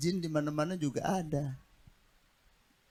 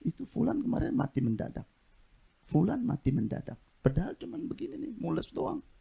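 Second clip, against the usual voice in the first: scaremonger visitor; 10 semitones higher than usual. Retold or imitated. Visitor scares the sick man.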